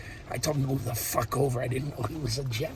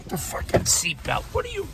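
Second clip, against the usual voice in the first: normal talking voice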